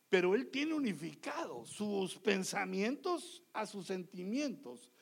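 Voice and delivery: normal talking voice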